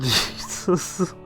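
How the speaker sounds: ordinary talk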